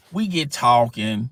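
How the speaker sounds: ordinary delivery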